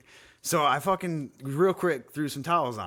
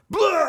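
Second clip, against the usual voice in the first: loud, high mock vomiting noise; retching blah; 10 dB louder than usual. Dad imitating his own retch to podcast cohost, a loud high mock heave. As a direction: belt out a hammed-up retch. Mimics throwing up on floor.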